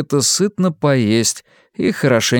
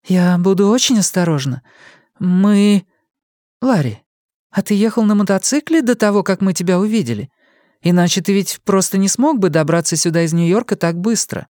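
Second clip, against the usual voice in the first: put-on eager young woman; 8 semitones higher than usual. A narrator's reading. Young woman warms to the motorcycle plan, eager. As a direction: let her brighten at the idea.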